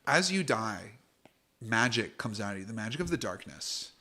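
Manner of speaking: hushed, unhurried scene setting; game master narration. Acted. GM to players, setting the death scene, hushed. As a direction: draw the players into the dark.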